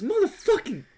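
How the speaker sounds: high, stretched curse at the cat; mock yelped curse. Nick Fury scratched by the cat, a high mock curse. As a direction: blurt the swear in pain.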